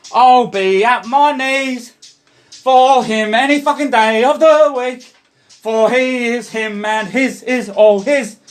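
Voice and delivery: slow, devotional preaching, voice lifted; fervent preaching. Acted. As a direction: preach it slowly, as if kneeling in worship.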